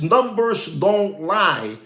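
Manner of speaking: mild imitation, deliberate and slow; slow mock analyst. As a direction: spell it out slowly like a serious analyst. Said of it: "he says".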